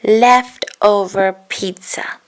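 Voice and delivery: slow, pouting sulk; sulky pout. A narrator's reading. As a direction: drag the words out sulking. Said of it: "Harry crossed his arms and pouted".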